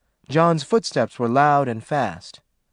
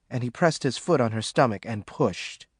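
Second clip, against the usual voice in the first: usual speaking voice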